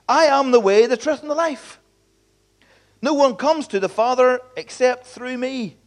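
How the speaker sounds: mildly exasperated, pressing the point; frustrated Jesus. Retold or imitated. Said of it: "a little bit of frustration in Jesus' voice".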